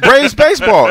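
high, loud retold shout; yelling a voice command. Host re-enacting a voice search for the game, yelling. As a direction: yell it at the remote. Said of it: "Yeah, I'm yelling".

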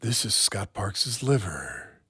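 slow, low mock attack-ad read; hammed-up political ad narrator. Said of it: "awful political ad voice on".

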